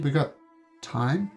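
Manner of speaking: ordinary delivery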